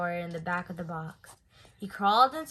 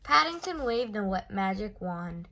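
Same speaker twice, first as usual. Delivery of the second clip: ordinary delivery, nothing marked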